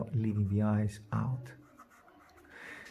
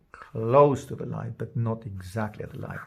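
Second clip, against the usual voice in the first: ordinary delivery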